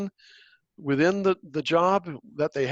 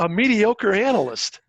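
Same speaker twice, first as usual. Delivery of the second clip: MEDIOCRE punched higher and louder; heavy emphasis on mediocre; 6 semitones higher, 5 dB louder than usual. Real, not acted. Speaker disparaging an analyst seen at the agency, heavily stressing "mediocre". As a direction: lean hard on the word "mediocre". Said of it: "heavy emphasis on the word mediocre".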